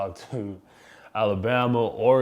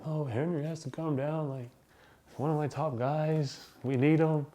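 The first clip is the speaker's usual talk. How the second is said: higher, coaxing sweet-talk, lightly imitated; butter him up like a recruiter; sweet-talking coach; 6 semitones higher than usual. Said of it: "Coach Saban just talks sweet".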